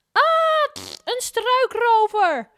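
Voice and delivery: very high, loud, slow scream; frightened scream. A narrator's reading. Mevrouw Poepies seeing a robber, high loud scream. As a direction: shriek in fright.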